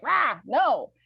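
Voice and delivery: mock dog growl. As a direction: bark it like the dog. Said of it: "Gidget will be like".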